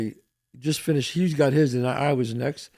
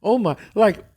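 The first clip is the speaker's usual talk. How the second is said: normal talking voice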